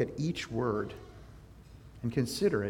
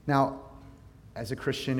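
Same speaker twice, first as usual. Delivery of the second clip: normal talking voice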